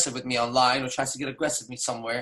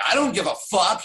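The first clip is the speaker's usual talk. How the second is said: put-on dismissive girl; 6 semitones higher, 3 dB louder than usual. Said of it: "they don't talk like that".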